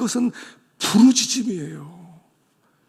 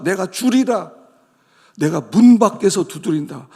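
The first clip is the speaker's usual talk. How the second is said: louder stern divine voice; God rebuking; 6 dB louder than usual. Acted. 하나님이 미지근한 성도들을 꾸짖으며, 크고 엄한 목소리. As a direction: rebuke a lukewarm people.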